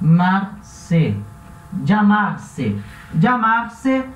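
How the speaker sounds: "llamarse" pronounced deliberately, Spanish way; Spanish pronunciation. Shown as a demonstration. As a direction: say "llamarse" clearly, teacher model. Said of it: "ele vai se chamar".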